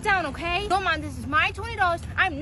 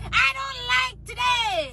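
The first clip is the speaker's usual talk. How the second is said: higher, slow drawn-out whiny yell; tantrum wail; 6 semitones higher, much slower than usual. Acted. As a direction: wail it like a sulking child. Kid's catchphrase tantrum.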